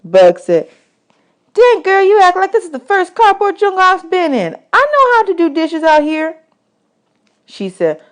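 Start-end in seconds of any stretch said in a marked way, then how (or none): 1.5-6.4 put-on higher, louder scrappy boy; Bugs' voice. Bugs snapping back at Desi, higher and louder. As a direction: talk back like a tough little kid.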